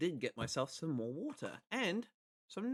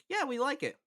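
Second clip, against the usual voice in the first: high-pitched cheery chorus of agreement; imitated approval in a much higher voice; mock group approval; 10 semitones higher than usual. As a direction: pipe it up high like a crowd won over. Voices the group coming round.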